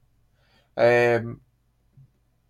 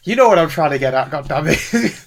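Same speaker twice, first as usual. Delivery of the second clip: exasperated laugh; 6 dB louder than usual. Real, not acted. Laughing exasperation at being teased.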